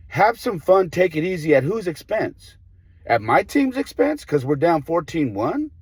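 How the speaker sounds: high, heated, disbelieving outburst; indignant retort. Retold or imitated. Coach firing back at the other coach, high and indignant. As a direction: throw his words back at him.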